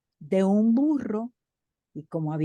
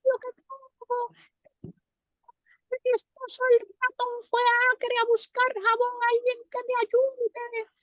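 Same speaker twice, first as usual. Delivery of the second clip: much higher, pleading cricket voice; desperate cricket. Acted. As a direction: beg for help as the tiny drowning wife. Drowning cricket keeps calling.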